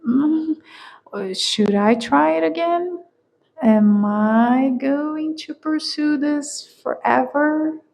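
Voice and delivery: a worn-out seeker wondering whether to go on; weary doubtful inner voice. Retold or imitated.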